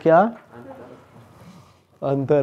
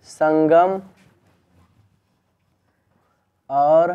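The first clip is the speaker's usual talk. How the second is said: one word at a time, long gaps; slow dictation; 3 dB quieter, much slower than usual. Real, not acted. Teacher dictates an exam question to the class, slow dictation. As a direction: dictate word by word, each held up unfinished.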